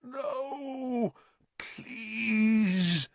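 high, drawn-out tearful plea; moaned. A narrator's reading. Sirius begs before the Dementors, moaning. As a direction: wail it softly, begging. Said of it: "he moaned".

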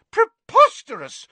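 very high, very loud outraged shout; shouting Fudge. A narrator's reading. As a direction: explode with outrage. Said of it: "shouted Fudge again".

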